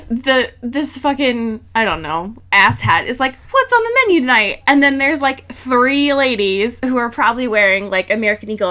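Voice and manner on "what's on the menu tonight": high, fast mock announcer; a hammed-up jingle intro, much higher and quick; dramatic ad voice. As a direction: ham up the ad's cheery opener. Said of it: "do a dramatic rendition".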